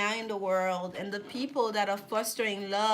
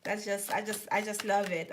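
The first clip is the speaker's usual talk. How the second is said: ordinary talk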